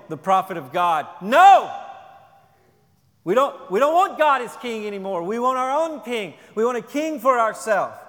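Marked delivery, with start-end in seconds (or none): 1.2-8.0 loud, higher, a stubborn shout for a king; defiant crowd yelling. Acted. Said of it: "and yelling and screaming at Samuel".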